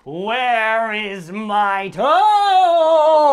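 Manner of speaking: very slow, loud moaning monster. A narrator's reading. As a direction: drag it out, looming over the bed. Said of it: "the voice groaned".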